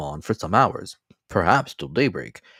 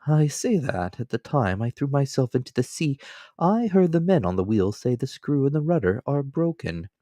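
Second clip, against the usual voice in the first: higher, polite, devoted servant voice; a loyal manservant, calm and deferential; Counsel; 8 semitones higher than usual. Acted. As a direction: servant offering his shoulder to his master, politely.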